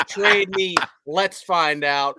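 slow, deliberate quote. Retold or imitated. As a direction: correct the wording by spelling it out slow. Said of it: "There's no punctuation".

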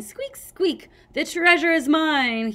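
high, loud mock squeaking gloat; hammed-up triumphant squeak; gloating scavenger. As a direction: crow over the stolen treasure.

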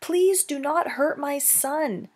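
begging. Acted. Mother begging the hunter to spare her son, pleading. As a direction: plead for mercy, voice lifted. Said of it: "begged Roz".

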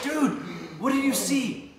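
high, loud alarmed asking; excited retold plea; urgent question. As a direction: demand to know what he saw. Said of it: "we're like".

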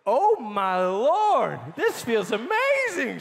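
hammed-up church-lady rapture, stretching every word; ecstatic amazement. Woman just prayed for at Kroger, retold in overjoyed amazement.

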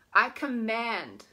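slow, firm I command; drawn-out declaration. Shown as a demonstration. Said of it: "I want you to say it".

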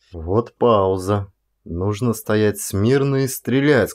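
usual speaking voice

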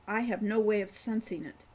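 usual speaking voice

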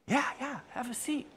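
soft, high, reassuring aside; hushed, lightly lifted voice, coaxing; gentle invitation. Acted. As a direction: quietly usher them to the seat. Officer role quietly invites you to sit.

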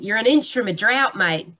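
playful demo of an Australian accent; Aussie accent. Shown as a demonstration. Speaker showing listeners an Australian accent, Aussie accent. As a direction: put on an Aussie twang. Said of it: "this is my Aussie accent".

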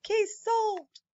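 triumphant shout. Acted. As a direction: celebrate the win, storybook style. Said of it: "the team celebrated and shouted".

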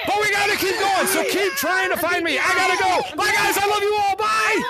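high, excited farewell shout; called-out goodbye. Real, not acted. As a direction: call goodbye to the whole crowd. Streamer calling goodbye to the lobby.

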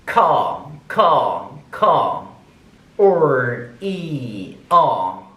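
syllables drawn out one at a time; sounding out phonetic symbols. Shown as a demonstration. Teacher repeating syllables for students to copy, deliberate. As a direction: drill each syllable clearly. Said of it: "반복하겠습니다".

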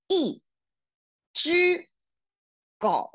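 一 只 狗 said one syllable at a time, 一 as a falling yì; teacher drilling tones syllable by syllable; slow syllable drill. Shown as a demonstration. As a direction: pace out 一 只 狗 slowly, each syllable apart. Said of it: "it is the fourth tone".

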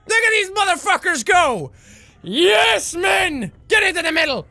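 high-pitched, thrilled yell; excited cheering. Real, not acted. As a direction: urge the troops on, thrilled. Cheering on his winning troops.

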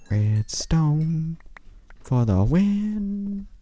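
drawn-out high cheer. Real, not acted. Gamer cheering after finding redstone, high and slow. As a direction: sing it out in triumph.

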